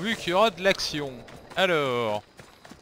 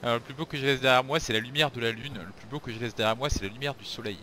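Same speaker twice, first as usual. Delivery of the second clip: rushed muttering; 7 semitones lower, 5 dB louder, much faster than usual. Real, not acted. Streamer rushes through the memorized poem.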